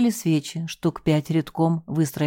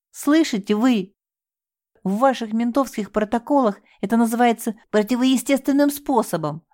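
high, raised, bitter confession; anguished outcry; 7 semitones higher than usual. Acted. Девушка с надрывом рассказывает следователю об отчиме. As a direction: hurl the truth at her.

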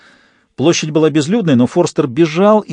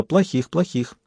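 soft little mumble, softer than the narration; muttering; 3 semitones higher, 6 dB quieter, slower than usual. A narrator's reading. Little girl to her toy hare, muttering. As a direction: mumble it softly to oneself. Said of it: "забормотала".

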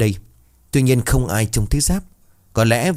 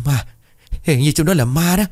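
high-pitched, fearful warning; frightened; 7 semitones higher than usual. A narrator's reading. Husband tells the group about the ghost, scared high voice. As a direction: stammer out the discovery in fright. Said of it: "ánh mắt tận cùng của sợ hãi".

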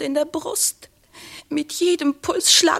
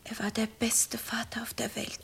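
soft whisper, much softer, voice nearly gone; grieving whisper; 2 semitones lower, 13 dB quieter than usual. Acted. Madelon tells the lady about her beloved dead father in a whisper. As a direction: breathe it out as if mourning.

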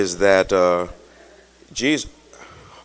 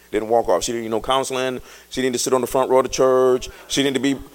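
ordinary talk

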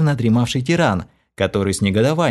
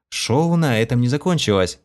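ordinary delivery

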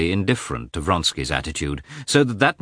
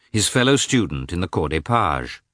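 plain reading voice

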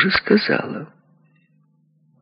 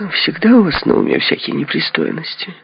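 high-pitched coquettish protest; affectedly prim and flirty; coy, simpering; 13 semitones higher than usual. A narrator's reading. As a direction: simper in a much higher voice. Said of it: "снова начиная жеманиться".

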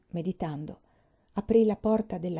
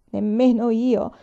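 slow, louder, higher acted wail; sobbing; 4 semitones higher, 7 dB louder, slower than usual. A narrator's reading. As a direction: cry it out slowly, without real sobs. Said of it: "singhiozzò Peonia".

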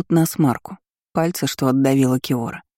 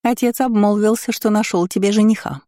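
chuckling taunt; 5 semitones higher than usual. A narrator's reading. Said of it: "хохотнула она".

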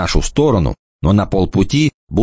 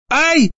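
high sharp "ouch" yelp; a quick pained cry; girl yelps when pinched; mock yelp of pain. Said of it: "вскрикнула она".

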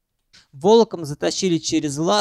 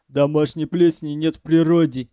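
ordinary delivery, nothing marked

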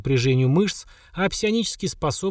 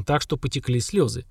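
plain reading voice

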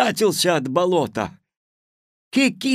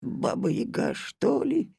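испуганно; 8 semitones higher, 6 dB quieter than usual. A narrator's reading. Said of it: "испугался Кузька".

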